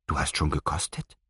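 hushed, leaning over to ask; whispering. A narrator's reading. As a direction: keep it soft and secret. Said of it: "wisperte Robert". (leise gewispert)

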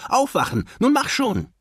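put-on high-pitched impatient child's call; urgent calling out. A narrator's reading. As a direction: rouse a sleeping dad in a high voice. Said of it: "rief er seinem Vater zu". (hoch und drängelnd rufen)

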